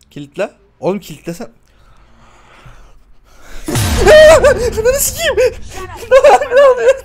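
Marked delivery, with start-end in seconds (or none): 2.6-4.7 high, loud frightened laughter; shrieking laugh. Real, not acted. Reacting to horror jump scare. 4.8-7.0 high, loud words broken by scared laughter; panicked laughing shriek. Real, not acted. Reacting to horror jump scare.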